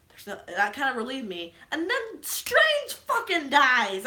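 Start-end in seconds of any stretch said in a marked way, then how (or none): 1.7-4.1 high, loud, drawn-out wail of words; loud distraught outburst. Real, not acted. Telling viewers that Strange dies, wailing in disbelief. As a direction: cry it out in exaggerated disbelief. Retelling her outrage at a death.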